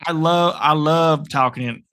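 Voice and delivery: slow, put-on Southern drawl; hammed-up Alabama accent. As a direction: stretch it out with a loud Southern twang. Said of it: "talking in a Southern accent".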